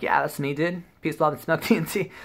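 breezy and cheeky, voice up high; cocky stoner. Retold or imitated. Cocky answer to the officer.

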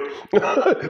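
high wheezing giggle; airy cackle; breathy high laugh. Real, not acted. Laughing fit.

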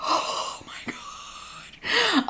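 slow high breathy gasp; overwhelmed whisper. Retold or imitated. Hearing Mattel praise her son, a slow stunned whisper. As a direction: choke it out, stunned. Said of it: "I was just like".

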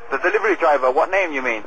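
normal talking voice

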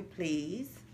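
plain reading voice

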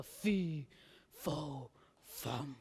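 very soft, low, spaced-out words; hushed anticipation. A narrator's reading. Narrator as Jack listens for the giant, hushed.

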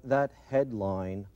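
ordinary delivery

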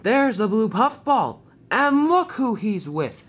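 loud, high, drawn-out taunt; triumphant storybook baddie; gloating villain. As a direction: crow slowly over your prey. Villain Diesel gloats over his find.

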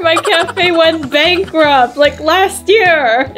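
put-on childish high pouty voice; Cherry character voice. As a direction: whine it out as the broke friend.

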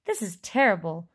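usual speaking voice

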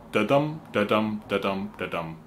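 da-dum with the weight on dum; meter beats. Shown as a demonstration. Songwriter tapping out the meter for viewers, dum stressed. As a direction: tap out the beat, landing on dum. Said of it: "the emphasis goes on the second syllable".

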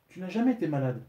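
plus aiguë et plus forte, catégorique; elle balaie la maladie d'un revers; Mildred sèche. A narrator's reading. Mildred contredit son mari malade. (épouse qui refuse de croire son mari, voix haute et ferme)

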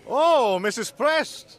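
high, loud surprised call, stretched out; calling back. Acted. Joe answers Mrs Prest from the bridge, high loud call. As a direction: shout down a glad greeting.

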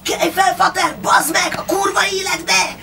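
wild junkie yelling to wake him; junkie shouting. A narrator's reading. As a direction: junkie Pista waking his fake father. Junkie shaking the sleeping man.

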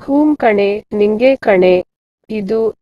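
usual speaking voice

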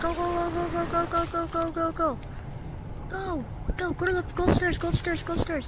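high urgent calling out; panicking gamer. Real, not acted. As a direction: call him on, urgent and high. Calling friend while fleeing.

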